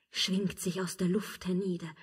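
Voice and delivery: normal talking voice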